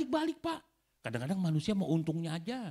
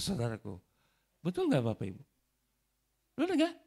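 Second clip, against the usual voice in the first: usual speaking voice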